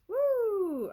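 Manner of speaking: loud, high "woo"; excited whoop. Real, not acted. Reader wrapping up after a reading she likes, whooping.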